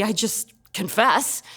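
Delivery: ordinary delivery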